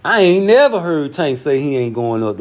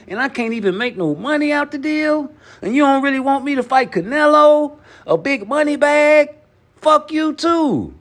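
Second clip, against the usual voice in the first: high-pitched, fed-up rant in a much higher voice; Crawford rant; 12 semitones higher than usual. Retold or imitated. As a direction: imitate a fighter telling everyone off, pitched way up. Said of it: "That's what I'll be saying if I was, uh, Terrence Crawford".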